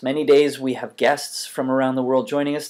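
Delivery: normal talking voice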